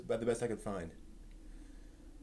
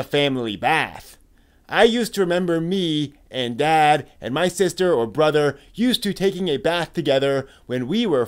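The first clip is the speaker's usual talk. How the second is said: forum post read aloud; 5 semitones higher, 13 dB louder than usual. Acted. Host reading an old anime forum post aloud, animated. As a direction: lively read of a fan's post, louder than his own talk.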